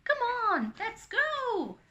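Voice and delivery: high, loud rallying call; excited urging. A narrator's reading. Joy rallying friends to join Anna, excited shout. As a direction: urge everyone to come along. Bored Joy rallies the others.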